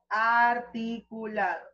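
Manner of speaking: Articulado, slow and carefully mouthed; clearly articulated. Shown as a demonstration. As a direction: shape each syllable of Articulado. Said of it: "Ese es articular". (Articulado pronunciado con cuidado)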